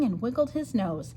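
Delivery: ordinary delivery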